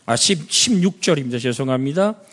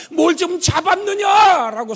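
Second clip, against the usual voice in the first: high, loud call across the water; Jesus shouting to the disciples across the water; 14 semitones higher, 5 dB louder than usual. Acted. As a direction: call out to the boat from the shore. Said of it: "소리 지릅니다".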